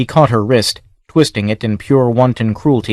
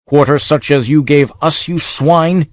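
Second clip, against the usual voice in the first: rising, higher, furious yell; maddened scream; 5 semitones higher than usual. A narrator's reading. Warrior screaming at the dying lord, high and furious. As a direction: rage as he cuts him down. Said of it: "His voice rose to a maddened scream".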